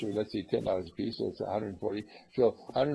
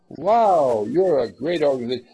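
acted amazement; 3 semitones higher, 10 dB louder than usual. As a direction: imitate a bowled-over caller gushing loudly. Acts impressed caller praising the group.

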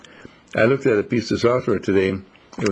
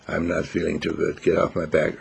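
put-on lower, softer grumbling old dog; grumpy old dog; 6 semitones lower, 4 dB quieter than usual. Sick older dog at the vet brushes off Rex, low grumble. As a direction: mutter it like a sick dog wanting peace. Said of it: "the other dog looks at Rex and said".

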